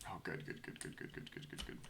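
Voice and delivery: breathy rapid whisper. Real, not acted. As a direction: whisper it under your breath. Muttered repeated goods.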